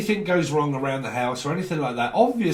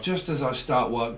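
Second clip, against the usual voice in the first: ordinary delivery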